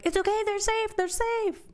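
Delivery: high-pitched, insistent mock pleading; urgent reassurance. Reader wanting to shake the characters, urgent high-pitched reassurance. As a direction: reassure them urgently, voice jumping way up. Urging characters they're safe.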